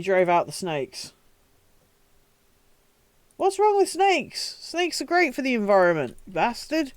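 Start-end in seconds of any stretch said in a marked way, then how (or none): 3.4-6.9 indignant and much higher, half-joking; mock outrage. Real, not acted. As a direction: rant in jokey indignation at the saint. Mock outrage at snake-driving saint.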